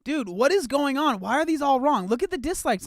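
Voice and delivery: loud, high-pitched incredulous outburst; exasperated disbelief. Real, not acted. Streamer to viewers about conflicting videos, exasperated disbelief.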